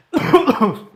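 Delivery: sudden harsh coughing fit; choking cough. Real, not acted. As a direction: cough hard like something went down wrong. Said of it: "sorry, I'm choking".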